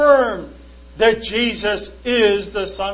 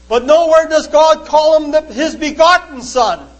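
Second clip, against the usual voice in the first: loud, higher, leaning hard on "begotten"; stress on begotten; 5 semitones higher, 6 dB louder than usual. Retold or imitated. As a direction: punch "begotten" as the skeptic's sticking point. Quoting skeptic's objection.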